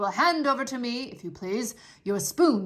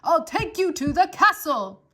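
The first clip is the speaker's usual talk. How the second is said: higher and louder, spelled out; repeating louder; 6 semitones higher, 10 dB louder than usual. Acted. Soldier repeating himself louder for deaf Mig. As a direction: repeat it loud for someone hard of hearing.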